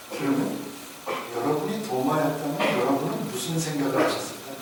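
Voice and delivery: ordinary talk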